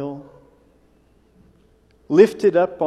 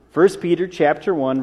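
ordinary delivery

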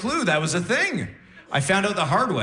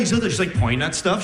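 ordinary talk, nothing marked

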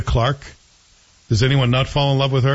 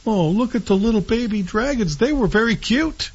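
high-pitched mock gushing; high, sing-song aww voice; cooing over babies; 9 semitones higher than usual. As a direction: coo as if at adorable little creatures. Gushing over baby dragons.

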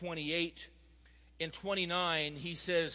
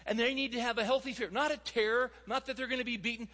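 higher-pitched, emphatic sermon delivery; impassioned preaching; 6 semitones higher than usual. Real, not acted. Preacher urging fathers to represent God, impassioned. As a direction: drive the point home to the congregation.

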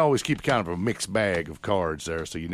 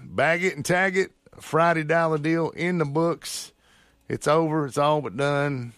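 high, punchy announcer wrap-up; radio sign-off; 9 semitones higher, 4 dB louder than usual. Acted. Radio host wrapping up the Friday deal show, punchy announcer sign-off. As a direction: swing up into showman mode to close.

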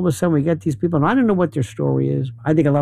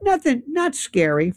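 mock breezy reassurance, pitched up; mock dismissive; 6 semitones higher than usual. Mocking people who say AI is nothing to worry about, pitched up. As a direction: brush it off like a smug skeptic. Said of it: "they say this with a straight face".

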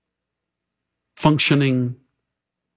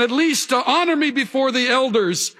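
pleading; 11 semitones higher than usual. A narrator's reading. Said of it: "Saul pleads again".

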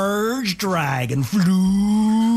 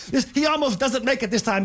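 plain reading voice

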